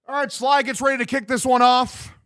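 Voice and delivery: high, loud sportscaster call of the kickoff; mock play-by-play. Streamer calling the kickoff for his boys, mock announcer. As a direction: hype it up like a TV announcer.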